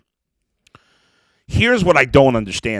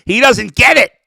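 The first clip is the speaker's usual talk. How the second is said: exasperated outburst; 9 semitones higher, 7 dB louder than usual. Retold or imitated. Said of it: "'cause I'm like".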